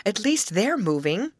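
high, elated exclamations; excited cries, pitched well up; surprised joy. A narrator's reading. Amy reports the alligators moving, surprised and joyful. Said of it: "gave a cry of surprise, of joy".

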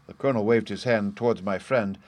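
usual speaking voice

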